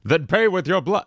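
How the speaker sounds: high, swooping game-guard voice; Oblivion guard. Acted. Gamer trying a guard impression, mock menacing guard. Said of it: "Oblivion Guard voice".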